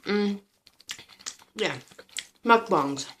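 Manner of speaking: messy loud eating while talking; mouth full. Real, not acted. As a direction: talk through a mouthful of food. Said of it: "talking with my mouth full".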